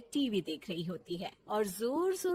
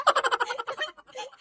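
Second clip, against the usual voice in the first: high burst of laughter; giggling; 14 semitones higher, 6 dB louder than usual. Acted. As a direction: giggle helplessly in a high pitch. Bahu still laughing at the TV.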